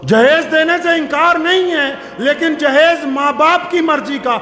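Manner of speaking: high, forceful pulpit rebuke; indignant preaching. Real, not acted. Preacher rebuking those who demand dowry, indignant preaching. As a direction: denounce them before the congregation.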